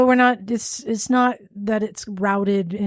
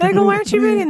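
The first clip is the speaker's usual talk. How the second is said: calling out; 10 semitones higher, 4 dB louder, much faster than usual. Real, not acted. Calling out to a co-host.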